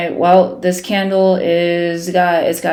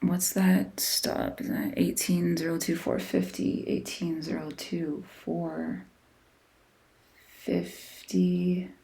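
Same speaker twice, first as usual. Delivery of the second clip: whispering to himself; 12 dB quieter than usual. Real, not acted. Checking numbers to himself.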